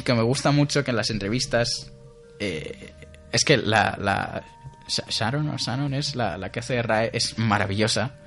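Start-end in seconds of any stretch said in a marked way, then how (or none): none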